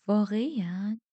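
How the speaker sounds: wondering, incredulous question; disbelieving. A narrator's reading. Girl asks if the creatures are real, disbelieving. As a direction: ask it with doubt swelling on the word. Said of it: "با ناباوری پرسیدم".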